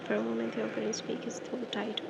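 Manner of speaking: plain reading voice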